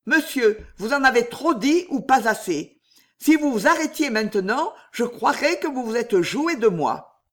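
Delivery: voix de femme plus haute, pressante; Juliette indignée. Acted. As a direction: exige des explications, le ton monté. Narratrice jouant Juliette. (personnage féminin piqué au vif, voix haute)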